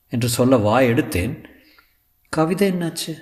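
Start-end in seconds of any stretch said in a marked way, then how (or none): 2.3-3.1 high, light questioning voice; Sulekha. Acted. Actress asking her tutor about the poem, high voice.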